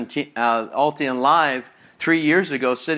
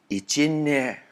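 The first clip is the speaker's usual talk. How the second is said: Prego mama; slower than usual. Retold or imitated. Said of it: "The mama looks at him and goes".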